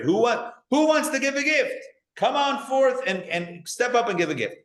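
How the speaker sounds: high-pitched appeal to a crowd for donors; calling out. Acted. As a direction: call them forward, pitched high, easing down at the end. Calling for volunteers to donate.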